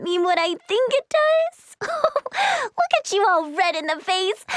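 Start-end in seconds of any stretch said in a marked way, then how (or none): 1.8-2.6 high-pitched giggle; teasing giggle. Acted. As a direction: giggle at catching someone out. Teasing Ray about his type.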